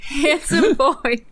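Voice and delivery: milk it like a fake sob; mock sob. Friend reads the fanfic with a mock cry. Hammed-up wail on reading.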